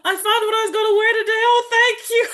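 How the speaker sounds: high, bright mock delight; delighted thanks. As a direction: gush thanks for a small win. Thanking for finding clothes.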